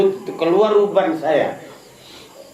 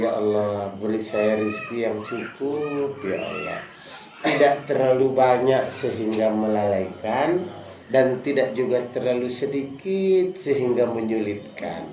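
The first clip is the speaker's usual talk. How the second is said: prayer spoken within the heart, fading away; whispered prayer; 7 semitones lower, 5 dB quieter than usual. Shown as a demonstration. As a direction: lower and much softer, almost inward. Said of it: "Semua bilang di dalam hati".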